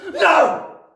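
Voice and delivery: high, very loud horrified cry; Trunchbull crying out. A narrator's reading. Headmistress reading Magnus's message, loud shocked cry. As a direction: burst out in disbelief. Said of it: "she cried".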